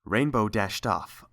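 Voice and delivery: usual speaking voice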